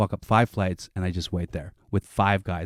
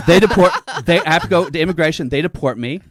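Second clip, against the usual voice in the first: laughing through it; 8 semitones higher, 7 dB louder than usual. Real, not acted. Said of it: "How do we make this story end".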